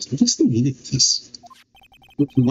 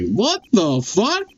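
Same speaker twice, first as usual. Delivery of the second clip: 1 slow baffled what; 7 semitones higher, much slower than usual. Real, not acted. Baffled by judge's ruling.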